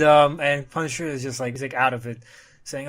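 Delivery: ordinary talk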